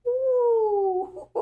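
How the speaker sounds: high squealing ooh into a giggle; giddy squeal and laugh. Real, not acted. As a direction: squeal with delight, then laugh. Squealing over the fine man.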